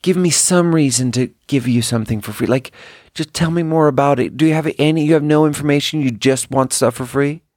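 normal talking voice

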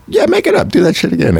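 high, eager approval; enthusiastic agency people. Retold or imitated. Agency writers urging him to improvise again, high and eager. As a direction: cheer him on. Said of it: "all of them were just like".

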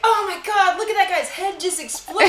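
high, loud and giggly; excited. Retold or imitated. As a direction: gush over the gore. Voicing her excited self.

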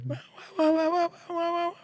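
very high screeched "wah wah" noises; mock screaming. Dumpling lady giving her film clip, high screeching wails. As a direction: squeal it in rapid, hammed-up bursts. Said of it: "she screams a lot".

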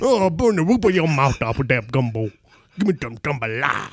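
mushy, garbled Creole parody; mock Creole accent. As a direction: smear the consonants in a silly Creole accent. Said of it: "dumbest accent is definitely Creole accent".

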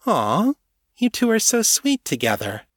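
high, sing-song teasing of the couple. Acted. Shapeshifter teasing the embracing couple, high mocking coo. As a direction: coo at them like a smug trickster.

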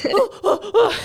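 high shivering cold gasps; shivering ooh. Retold or imitated. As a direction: yelp from icy water. Cold ocean swim reaction.

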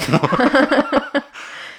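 bright high-pitched laugh; a giggle that jumps high; high burst of laughter. Real, not acted. Laughing at her own answer.

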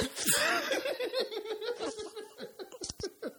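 high laugh ending in a snort. Real, not acted. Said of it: "Did you just snort?".

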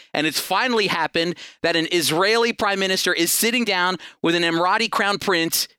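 usual speaking voice

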